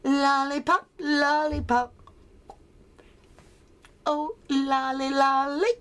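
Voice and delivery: high, sing-song candy-seller's call; put-on sweet-hawking villain voice; Child Catcher. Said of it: "She is the child catcher".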